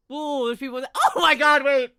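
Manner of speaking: high, loud excited cry. Real, not acted. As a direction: gasp and cry out in delight. Excited at new guests.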